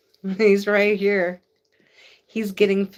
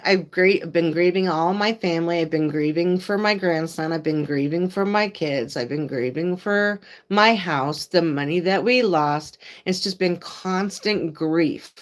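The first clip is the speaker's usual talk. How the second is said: normal talking voice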